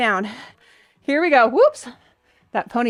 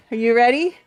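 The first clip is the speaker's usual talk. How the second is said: ordinary delivery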